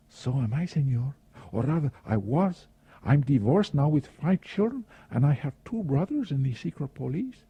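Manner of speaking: senorita voice. A narrator's reading. Senorita's reply, voiced higher.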